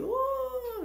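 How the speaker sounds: very high drawn-out "oooh"; imitated wail. [name] as the alien sucks out his brain, high mock wail.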